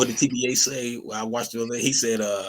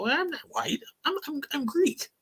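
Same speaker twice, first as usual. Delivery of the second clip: high mock voice of the Greek kid; 4 dB quieter than usual. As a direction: squeak it out, protesting. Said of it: "be like".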